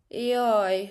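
long, loud storybook ow; wailing. A narrator's reading. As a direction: draw out a plaintive cry of pain. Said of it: "siránkozott Luca".

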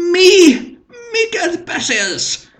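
very high, loud screeching cry; a cracked, crying shriek of fury. A narrator's reading. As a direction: screech in outraged disbelief. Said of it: "rikácsolás".

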